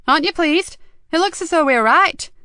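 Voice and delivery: keyed-up, pitch lifted right up; excited boss. A narrator's reading. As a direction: prod a colleague to share the triumph. Nikki excited, questions Joseph.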